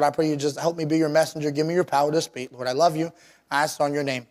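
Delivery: ordinary delivery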